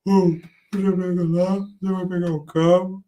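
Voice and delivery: loud, bossy imitation of the old chief; imitating the boss. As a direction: bark orders like an angry boss. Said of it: "Ô, Paulão, falava assim".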